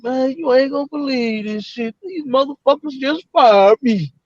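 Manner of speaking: imitating an upset, high-pitched lament; heartbroken. Fired truck driver venting to a friend, heartbroken. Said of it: "See, he was heartbroken".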